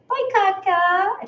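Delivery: put-on two-year-old proudly waving goodbye; toddler voice. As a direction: imitate a tiny boy saying bye. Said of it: "And he goes".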